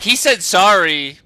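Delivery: mock lawyer threat. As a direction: bluff like a smug litigant. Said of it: "You should have just been like".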